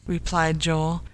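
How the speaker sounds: ordinary delivery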